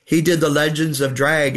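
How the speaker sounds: usual speaking voice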